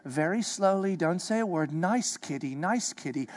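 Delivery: soft, careful hush backing away; cautious hush. Shown as a demonstration. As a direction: coax the lion gently and softly. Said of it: "you do things like this".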